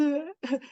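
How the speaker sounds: quick laugh at herself; brief amused chuckle; small laugh; soft laugh. Real, not acted. Laughs at her own ignorance.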